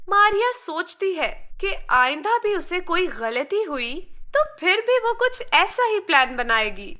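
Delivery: ordinary delivery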